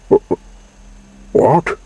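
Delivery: stammer, then a high, loud "what?"; stuttered. A narrator's reading. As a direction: trip over the start, then blurt the word out. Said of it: "Maurice stuttered".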